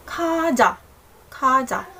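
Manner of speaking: KAJA with lightly aspirated k, falling end; correct Korean kaja. Shown as a demonstration. Teacher introduces the next word, KAJA with airy k and falling end. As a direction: stretch KA slightly, drop on JA. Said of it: "Should be kaja".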